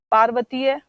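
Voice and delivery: ordinary delivery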